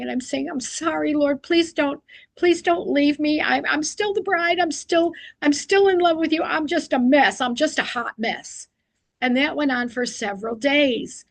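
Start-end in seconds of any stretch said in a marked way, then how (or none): 0.5-8.7 a higher, pleading retold prayer to the Lord; replaying her tearful begging, a little higher; retold tearful plea. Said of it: "I would spend my time weeping and crying".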